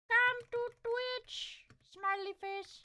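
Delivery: high, drawn-out little voice; put-on cutesy voice. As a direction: coax them in a slow, high squeak. Voicing a message to YouTube viewers.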